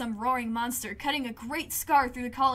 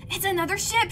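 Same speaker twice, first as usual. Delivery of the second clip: higher, louder thrilled cry; exclaiming; 7 semitones higher, 6 dB louder than usual. Acted. Mars grabbing your shoulder, exclaiming. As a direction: grab a friend and exclaim. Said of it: "she exclaims".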